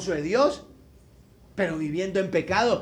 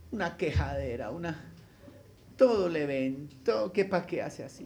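ordinary delivery

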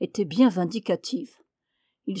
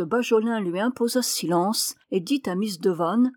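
usual speaking voice

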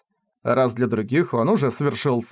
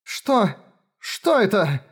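high, startled and afraid; frozen with fear, asking; frightened; 7 semitones higher, much slower than usual. Acted. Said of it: "застыв от страха".

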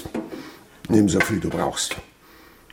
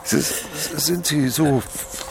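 halting, stammered start, slower; stuttering; 5 semitones higher, slower than usual. Acted. Miser stammering at the notary to stall, feigned stutter. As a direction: stammer to buy time.

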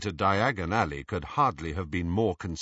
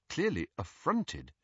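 usual speaking voice

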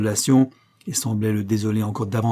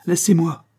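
higher, louder frantic cry; 7 semitones higher, 5 dB louder than usual. A narrator's reading. John pushing the coroner away, loud cry. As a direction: cry out to be left alone. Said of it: "s'écria John".

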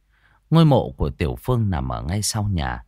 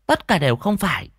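put-on high child voice; boy dismissing guesses; 11 semitones higher than usual. Boy rejects every guess, high childish voice. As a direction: knock down the guesses in a boy's high pitch.

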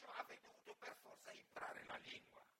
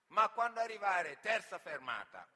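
mock broken Italian; 18 dB louder than usual. Local questions the immigrant in loud mock foreigner-talk. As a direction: spell it out too loudly.

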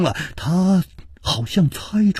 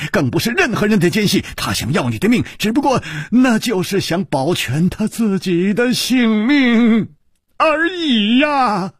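raised, rushing angry tirade, slowing on the last words; angry roar; 7 semitones higher than usual. A narrator's reading. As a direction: hurl it at him in fury. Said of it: "李玉涵怒吼道".

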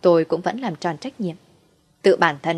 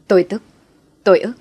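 burst of rage; 2 semitones higher, 7 dB louder, faster than usual. Acted. Husband's rage at betrayal.

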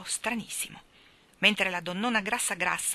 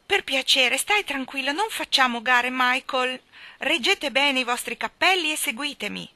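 loud, higher, brisk governess command; Mary Poppins giving orders; 5 semitones higher, 6 dB louder than usual. Acted. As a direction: marshal the children firmly, voice raised.

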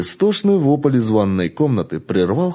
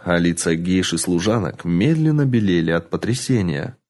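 usual speaking voice

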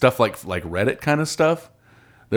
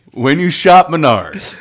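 slow, higher mock ad tagline; ad jingle voice; 4 semitones higher, 6 dB louder, slower than usual. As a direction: sell it like a cheesy store ad. Parody ad tagline.

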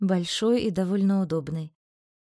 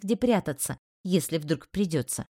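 ordinary delivery, nothing marked

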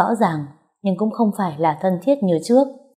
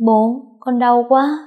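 high, slow, whimpering complaint; 5 semitones higher, 3 dB louder, slower than usual. A narrator's reading. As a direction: sob to her father for pity. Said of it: "nép phía sau thút thít". (con gái thút thít với bố, giọng cao, chậm)

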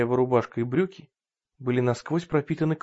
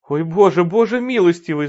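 loud, higher lamenting wail; 5 semitones higher, 8 dB louder than usual. Acted. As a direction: wail to God in despair. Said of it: "запричитала женщина".

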